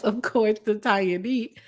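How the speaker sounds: ordinary talk, nothing marked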